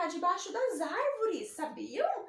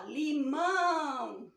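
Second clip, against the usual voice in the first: slow Limão; 2 semitones lower, much slower than usual. Shown as a demonstration. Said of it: "Limão no final".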